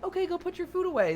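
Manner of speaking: very high sing-song teacher voice; sweet preschool teacher. Retold or imitated. Teacher answering a little girl who finished eating, high and sweet. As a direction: coo it to a small child. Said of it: "And I'm like".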